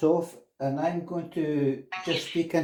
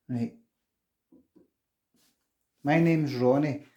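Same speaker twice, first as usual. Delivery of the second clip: usual speaking voice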